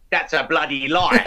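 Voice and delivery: a hint of a famous actor's indignant line; Michael Caine. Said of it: "like Michael Caine".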